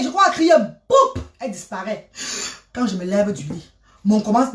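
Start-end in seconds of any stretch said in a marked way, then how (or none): 0.9-1.2 sharp high boum; sudden boom. Retold or imitated. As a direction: make the burst of her vanishing. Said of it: "she vanishes".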